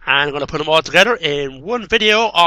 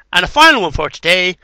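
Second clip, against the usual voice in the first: normal talking voice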